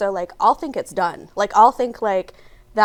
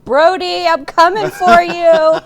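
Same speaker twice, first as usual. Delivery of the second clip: slow, loud, laughing tease; playful laughing threat; 4 semitones higher, 8 dB louder, much slower than usual. Acted. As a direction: tease it out slowly.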